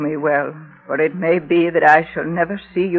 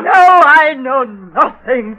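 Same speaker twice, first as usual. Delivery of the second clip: slow panicked shout; 6 semitones higher, 4 dB louder, slower than usual. Acted. Witch denying in panic.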